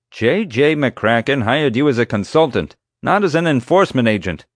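loud, high-pitched rebuke; officious security chief. A narrator's reading. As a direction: put a subordinate in his place. Chief argues over authority.

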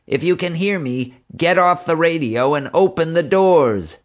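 high, urgent order rising in volume; desperate. A narrator's reading. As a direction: plead and command over a bad radio link. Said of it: "Marge said desperately".

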